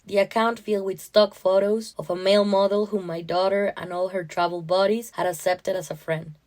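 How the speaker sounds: ordinary delivery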